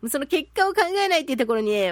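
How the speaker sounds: ordinary delivery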